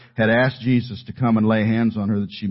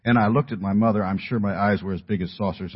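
ordinary talk

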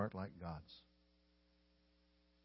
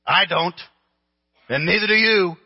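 emphatic shout; 9 semitones higher, 12 dB louder than usual. Real, not acted. Preacher's emphatic aside.

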